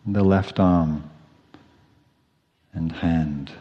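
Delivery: quiet, spacious body-scan voice with long pauses; hushed, even meditation cues, falling away softly; guided meditation. Real, not acted.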